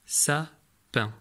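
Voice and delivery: "sapin" split in two, sa, pause, pin; "sapin" as two separate beats; syllable by syllable. Shown as a demonstration. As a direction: sound out "sapin" one syllable at a time. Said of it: "vous lui demandez".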